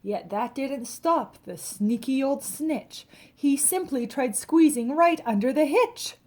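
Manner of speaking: ordinary delivery, nothing marked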